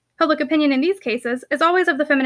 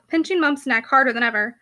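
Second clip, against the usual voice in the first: plain reading voice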